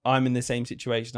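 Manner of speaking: ordinary talk, nothing marked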